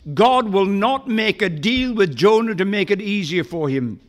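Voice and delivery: ordinary delivery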